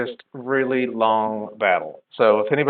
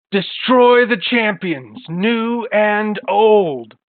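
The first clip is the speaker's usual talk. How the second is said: loud, high, drawn-out battle cry; screamed villain command; 8 semitones higher, 5 dB louder, much slower than usual. Acted. Old man chopping wood orders his clones to attack, screaming out. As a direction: cry out the order, stretching every word. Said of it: "and then he screams out".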